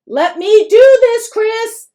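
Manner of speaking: wife cutting off her husband; warning. A narrator's reading. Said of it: "Mom says warningly".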